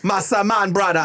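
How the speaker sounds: playful Jamaican-style call; mock Jamaican accent. Co-host playing with the curry's name, mock Jamaican accent. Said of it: "I don't know why it sounds Jamaican".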